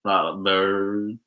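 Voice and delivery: very slow, stretched Canadian-accent VIOLET BIRD; badly faked Canadian accent; attempted Canadian accent. Shown as a demonstration. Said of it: "how do Canadians talk?".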